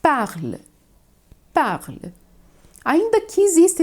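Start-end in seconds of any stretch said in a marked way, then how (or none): 0.0-0.6 parle, one syllable, the e dropped; French parle. Shown as a demonstration. Teacher models parle for students, silent final e. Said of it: "eu vou dizer". 1.5-2.1 parle, one syllable, the e dropped; French parle. Shown as a demonstration. Teacher models parle for students, silent final e. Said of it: "eu vou dizer".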